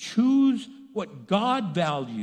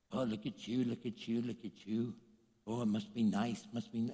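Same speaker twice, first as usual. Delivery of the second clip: low, hushed mocking murmur; mock envy; 8 semitones lower, 9 dB quieter than usual. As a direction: imitate envious onlookers under your breath. Mimics envious onlookers sneering.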